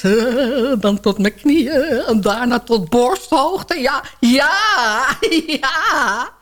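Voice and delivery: ordinary talk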